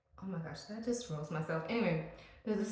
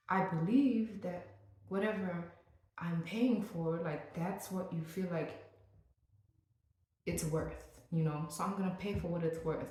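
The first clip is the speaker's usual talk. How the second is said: ordinary talk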